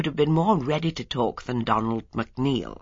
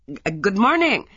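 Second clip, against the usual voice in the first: startled stammer; 8 semitones higher, 5 dB louder than usual. A narrator's reading. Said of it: "said Ella, and added quickly".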